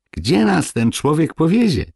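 high, loud little dwarf; the dwarves wonder aloud, high and worried. A narrator's reading. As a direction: fret like a tiny man. Dwarves fretting on the cart.

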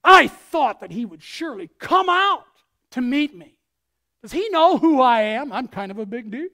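loud, offended boast of a self-important general; proud indignant Naaman. Retold or imitated. Preacher voicing proud Naaman to the congregation, loud and indignant. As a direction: act out a pompous man insulted he wasn't met in person. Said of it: "I want you to get the inflection".

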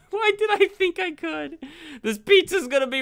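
high, loud self-mocking wail; laughing at his own mistake, loud; real dismay at his own blunder; exasperated outburst. Real, not acted. Drove pizza into the water.

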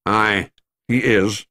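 slow, heavy Scottish "Aye, he is"; a grave Scots doctor breaking bad news. A narrator's reading.

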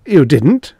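high, loud shocked protest; gasped denial. A narrator's reading. Young doctor contradicting Stark's accusation, gasping. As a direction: deny it, aghast. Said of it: "doctor gasped".

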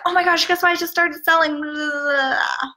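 high, breathless "guess what" blurt; mock gushing pitch. Seller blurting a pitch at a friend, hammed-up excitement. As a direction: blurt the sales news at a friend. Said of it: "You would never go up to a friend and be like".

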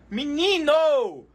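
shocked exclamation. Real, not acted. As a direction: stretch it out in astonishment.

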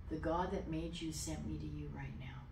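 near-whispered, solemn message; quiet, breath-light delivery; hushed declaration. Retold or imitated. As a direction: say it very softly, trailing into a whisper. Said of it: "Just like that".

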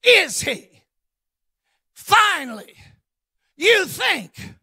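Big Martha scoffing at the news; sarcastic retort. Retold or imitated. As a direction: throw it back with a sneer of doubt. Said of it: "Here she goes".

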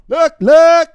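loud, high, punchy catchphrase yell; hype shout. Pretend. Streamer hyping his viewers with a loud high shout. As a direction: bark it out like a hype man.

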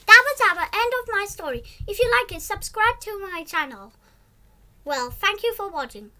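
fast, high and loud sign-off; excited outro. Real, not acted. Child asking viewers to subscribe, excited. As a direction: rush the goodbye with glee.

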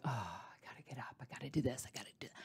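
whispered morning thoughts to herself; waking in bed, whispered grumble to herself. Retold or imitated. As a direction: breathe it out under the breath. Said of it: "and I'm like".

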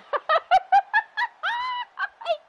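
shrieking burst of laughter; high laugh. Real, not acted. Cracks up at choke joke.

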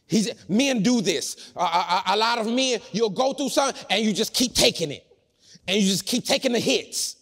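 loud, high, impassioned preaching; full-force preaching. Real, not acted.

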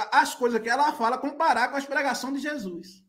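normal talking voice

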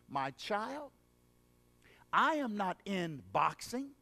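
gentle voice of Jesus. Acted. Jesus speaking tenderly to the sports-loving believer, soft. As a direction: speak softly, like a father to a child.

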